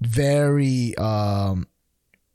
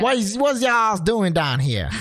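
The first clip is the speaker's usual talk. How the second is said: higher-pitched mock Southern drawl; Southern accent; 7 semitones higher than usual. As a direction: put on an Alabama twang. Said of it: "that southern, like".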